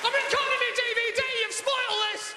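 high, loud comic scolding; mock outrage. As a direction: scold the crowd in hammed-up fury.

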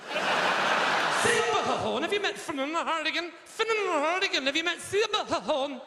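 mock introduction. Mock introduction of silly names.

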